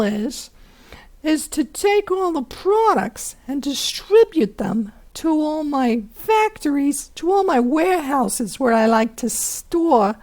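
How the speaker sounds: high, loud hammed-up Italian mobster accent. Teacher playing the Golgi apparatus for students, as a hammed-up Italian mobster. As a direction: swagger it like a 1920s Italian mob boss. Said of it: "do my Italian mobster voice, but I'm gonna try".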